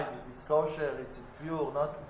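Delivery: normal talking voice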